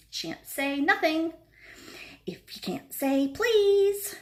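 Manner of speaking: high, slow singsong teasing; sing-song poltergeist. A narrator's reading. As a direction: draw it out to annoy him. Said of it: "annoying, sing-songy voice".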